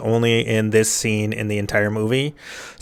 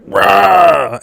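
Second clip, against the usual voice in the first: loud, higher-pitched playful roar; mock monster roar; 8 semitones higher, 6 dB louder than usual. Monster finds James in the closet, loud mock roar. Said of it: "Pyramid Head is like".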